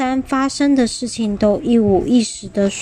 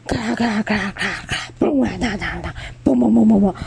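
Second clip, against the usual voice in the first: 快速念出的碎石声; 模仿石头哗啦滚落的声音; 拟声石头滚落; much faster than usual. Acted. 石头滚落的拟声. (快速拟声的石头崩落)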